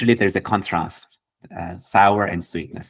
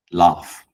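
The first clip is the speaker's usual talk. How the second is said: a loud falling laugh; emphatic laugh; 6 dB louder than usual. Shown as a demonstration. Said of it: "Laugh like a flower".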